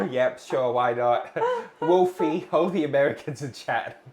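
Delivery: loud laughing call to chat; laughing shout-out. Real, not acted. Host laughs loudly, calling out Americans in chat. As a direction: laugh while you call them out.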